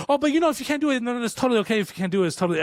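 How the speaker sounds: high-pitched, rattled backpedaling; retold freak-out. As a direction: replay yourself frantically taking it back. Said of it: "I'm, like, freaking out".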